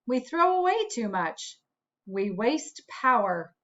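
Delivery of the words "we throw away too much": plain reading voice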